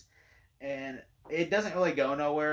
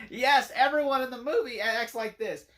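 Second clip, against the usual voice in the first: emphatic agreement; 5 semitones higher, 4 dB louder than usual. Retold or imitated. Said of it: "I'm like".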